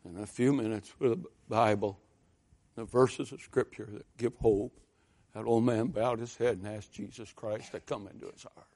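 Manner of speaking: normal talking voice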